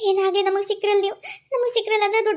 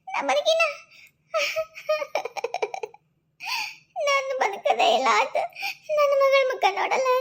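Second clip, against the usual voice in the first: laughing through tears; 4 semitones higher than usual. Acted. Mother repeats she survived, crying.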